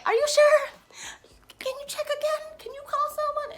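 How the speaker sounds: high, soft plea; very high and soft, retold pleading; timid pleading. Retold anxious plea to driver.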